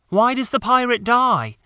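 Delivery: put-on a boy asking why, a little indignant; young boy. John asks Michael why the pirate dies, high boy voice.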